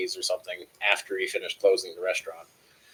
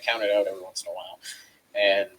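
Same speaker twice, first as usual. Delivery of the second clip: usual speaking voice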